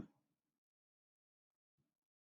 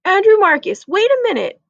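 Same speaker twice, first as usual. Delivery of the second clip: mother calling; 8 semitones higher, 3 dB louder than usual. Acted. Mother stops son at door.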